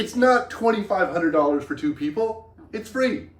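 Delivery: loud, high emphasis; excited emphatic. Real, not acted. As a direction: press home how great it is. Excited about free skiing.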